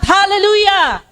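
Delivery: high ringing call of praise; shouted hallelujah. Shown as a demonstration. Preacher calling the church to shout it. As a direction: lead the crowd, call it out high. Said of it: "can I hear the people say with a shout".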